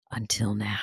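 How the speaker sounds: breathy teasing whisper, voice dropped right down; dramatic whisper. Pretend. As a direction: whisper it like a cliffhanger.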